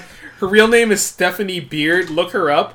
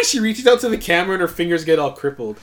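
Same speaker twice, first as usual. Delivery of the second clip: normal talking voice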